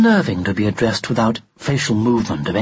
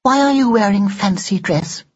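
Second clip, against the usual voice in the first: higher, prim Victorian doll; lightly voiced haughty doll; 7 semitones higher than usual. Doll questions Ned.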